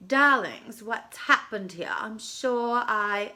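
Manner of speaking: grand, slow theatrical star voice; dramatic diva. A narrator's reading. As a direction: play a soap opera diva. Diva character speaks.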